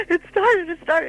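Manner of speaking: high, loud re-enacted alarm; acting out a frantic announcement; retold panicked cry. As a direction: cry it out as if panicking, after a gasp. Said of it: "I was really upset, and the tears were coming".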